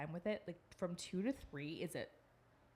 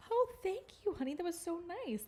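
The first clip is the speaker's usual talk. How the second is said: much higher, sing-song praise; sweet mom voice; 11 semitones higher, 3 dB louder than usual. Retold or imitated. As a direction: coo it to a toddler. Mom praising her son.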